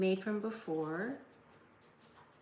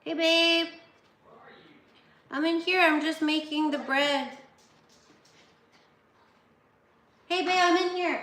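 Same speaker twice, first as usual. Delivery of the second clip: raised voice calling to another room; calling down a hallway; 8 semitones higher, 11 dB louder than usual. Real, not acted. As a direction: call out loud and high.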